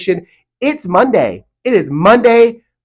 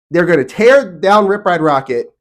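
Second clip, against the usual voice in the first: ordinary talk, nothing marked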